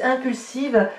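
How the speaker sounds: ordinary talk, nothing marked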